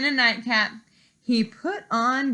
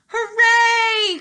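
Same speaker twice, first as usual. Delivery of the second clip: high, loud joyful cheer; hooray; 12 semitones higher, 8 dB louder than usual. Acted. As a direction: cheer like a delighted child.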